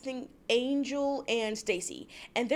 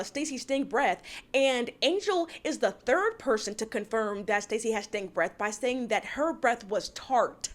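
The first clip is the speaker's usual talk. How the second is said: ordinary delivery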